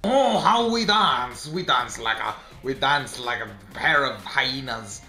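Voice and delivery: hammed-up mock French, drawn out; badly faked French accent. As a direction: put on a wobbly French accent. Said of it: "What the heck accent is this?".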